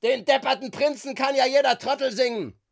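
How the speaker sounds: high, loud quarrelling shout; angry outburst. A narrator's reading. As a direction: hurl the insult at full voice. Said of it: "Der Streit hatte in Hans Ausruf gegipfelt". (wütender Ausruf)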